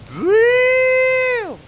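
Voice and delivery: high, loud, stretched-out amazed damn; be the stunned viewer. Retold or imitated. Voicing viewers amazed at the crib, a high loud drawn damn. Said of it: "I know you guys like".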